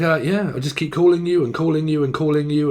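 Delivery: usual speaking voice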